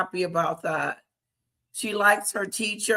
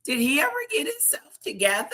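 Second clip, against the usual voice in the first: a touch higher, quick curious ask; lightly mimicked question; 7 semitones higher, 3 dB quieter, faster than usual. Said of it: "I said".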